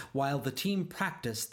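ordinary delivery, nothing marked

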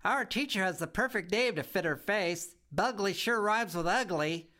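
put-on high, loud, giggling kid voice; a cheeky little girl laughing at the house; giggly bratty girl. A narrator's reading. Girl giggling at her new home, high and cheeky. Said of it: "She whispered just as loud as could be".

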